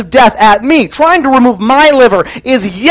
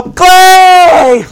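cry for help; 5 semitones higher, much slower than usual. Retold or imitated. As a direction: cry out for vengeance. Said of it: "in my cries of help".

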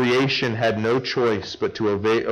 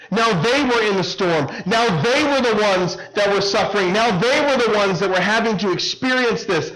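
higher, louder preaching voice, pressing hard; full-force preaching; 8 semitones higher, 3 dB louder than usual. Acted. Preacher driving the congregation through the storm, full-force preaching. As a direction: preach it with rising urgency to the crowd.

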